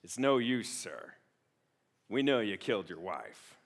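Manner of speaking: a hint of Columbo closing the case; gravelly Columbo. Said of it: "in that gravelly voice".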